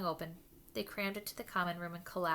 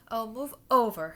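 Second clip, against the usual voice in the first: loud, higher impatient snarl; 4 semitones higher, 12 dB louder than usual. Acted. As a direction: shove them aside with your voice. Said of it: "Hermione snarled".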